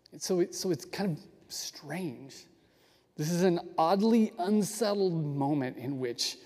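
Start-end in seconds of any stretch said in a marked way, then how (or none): 0.1-2.3 whisper. Real, not acted. Teacher whispering to viewers about the strange moment. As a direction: let it fall to a whisper. Hushed comment on the odd moment.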